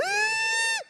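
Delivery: loud, piercingly high shriek; brief comic squeal; mock high squeal. Acting out the missing squeal.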